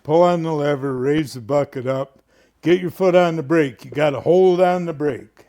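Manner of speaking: put-on loud barked orders to the kid; mock bossy operator; imitating George instructing. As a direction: call out each step from the track. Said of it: "and he's going".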